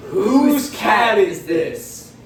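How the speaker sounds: movie title announcement. Acted.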